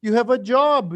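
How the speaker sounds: high, loud chorus of frantic no's; gods protesting. Retold or imitated. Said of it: "Brihaspati and Vishnu and Shiva say".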